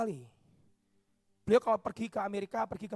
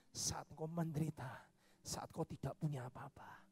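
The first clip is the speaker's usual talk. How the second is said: softened divine voice; 7 semitones lower, 10 dB quieter than usual. Acted. God's voice softens.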